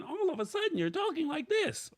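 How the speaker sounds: squeaky-high demo of inconsistent speaking; sudden jump in voice. Shown as a demonstration. Showing viewers what not to do, sudden high voice. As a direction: abruptly leap into a very high pitch. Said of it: "at a consistent volume".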